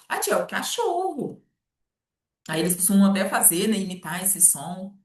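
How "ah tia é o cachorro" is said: put-on much higher little-kid voice; child's voice. Child explaining the dog sound to the teacher. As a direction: squeak it like a young child. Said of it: "Mas o que que é au?".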